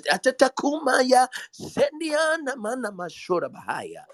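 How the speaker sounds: speaking in tongues. Real, not acted. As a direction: pour out tongues with fervor. Tongues amid preaching.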